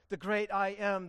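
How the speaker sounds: normal talking voice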